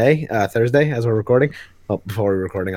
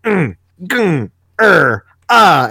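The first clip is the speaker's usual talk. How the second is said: loud, strained comic-book grunts; mock fight grunting; 6 semitones higher, 4 dB louder, much slower than usual. Imitating grunts on every other page, mock fight grunting. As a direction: grunt out each blow, pitched up. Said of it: "had them grunting the entire fight".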